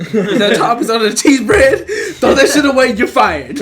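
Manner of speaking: loud laughing mock tirade; mock boss scolding. Co-manager scolding the worker, loud mock tirade. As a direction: bark it like a furious boss.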